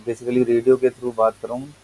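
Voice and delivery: normal talking voice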